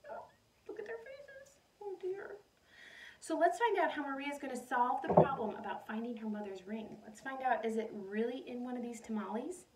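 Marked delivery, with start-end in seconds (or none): 0.1-5.0 sympathetic aww. Real, not acted. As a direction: coo over their worried faces. Teacher reacting to picture sadly.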